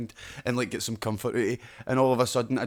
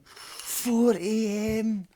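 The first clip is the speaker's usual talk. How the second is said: "Four A M" drawn out very slow and higher; emphatic repeat; 9 semitones higher, much slower than usual. Shown as a demonstration. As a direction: stretch every word of "Four A M" for effect. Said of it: "Mate, you can get drink delivered in there to four A M".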